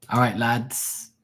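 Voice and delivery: drawn-out put-on Scottish accent; Scottish dad accent. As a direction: round up the lads in a mock Scottish accent. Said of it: "they all talk like that".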